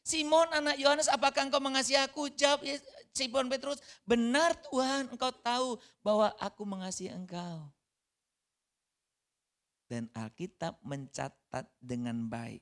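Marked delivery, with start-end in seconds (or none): 0.1-2.3 high, loud, urgent asking; preacher booming Jesus' question; loud pressing question. Acted. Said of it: "Ditanya lagi". 2.3-7.7 high, loud, impassioned sermon delivery; fervent pulpit voice retelling the dialogue; full-force preaching. Acted.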